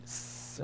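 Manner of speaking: S as a bare voiceless hiss; just hiss the S; Arabic letter sound demo. Shown as a demonstration. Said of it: "Like a hissing sound".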